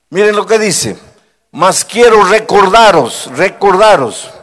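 high, loud pulpit preaching, every phrase driven hard; preaching at full force. Acted. Pastor warning the stadium crowd, loud fervent preaching. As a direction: thunder the warning at the whole stadium.

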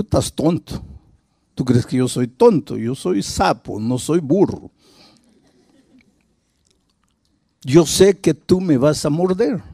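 low, quiet scoffing, lightly voiced; a distrustful frog refusing, voice dropped low; wary frog. Frog refuses the scorpion, low quiet scoffing.

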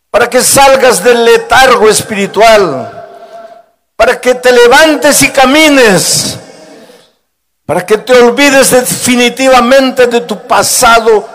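high, loud pulpit preaching, every phrase driven hard; preaching at full force. Acted. Pastor warning the stadium crowd, loud fervent preaching. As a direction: thunder the warning at the whole stadium.

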